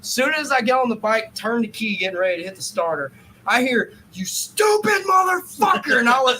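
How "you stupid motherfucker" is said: high, loud, slow furious yell; angry shout. Retold or imitated. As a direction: scream the insult across the lot. Retold stranger yelling at him.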